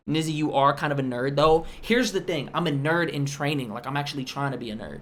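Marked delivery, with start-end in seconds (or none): none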